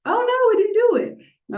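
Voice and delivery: high, loud "oh no"; sudden high dismay; dismayed surprise. Real, not acted. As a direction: caught off guard as the app fails. App fails during live.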